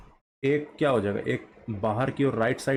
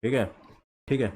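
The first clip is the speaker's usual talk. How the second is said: ordinary talk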